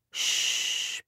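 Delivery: soft whispered shh; calm the baby gently; soothing shush. A narrator's reading. Father gently whispering shh to soothe the whimpering baby. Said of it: "soothing Linus".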